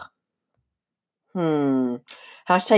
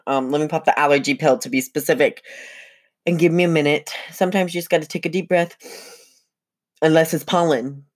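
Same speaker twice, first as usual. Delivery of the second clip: ordinary delivery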